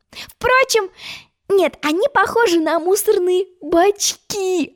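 put-on high, slow teasing girl's voice; high amused girl; giggling girl. A narrator's reading. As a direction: hold back a giggle as she teases. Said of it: "хихикала Алиса".

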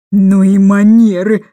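a loud, slow outraged cry; indignant exclamation. Acted. Aunt exclaims loudly at the sobbing twins. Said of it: "воскликнула мисс Эндрю".

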